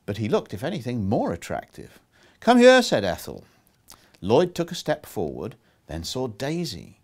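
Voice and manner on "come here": loud, high-pitched summons; calling over. A narrator's reading. Ethel summoning Lloyd from the crowd, calling over. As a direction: call him over with a raised voice.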